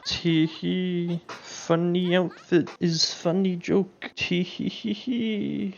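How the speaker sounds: slow, mock-dainty spoken tittering; mock giggling. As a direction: drag out a fake, sarcastic tee-hee. Mocks the show's silly joke.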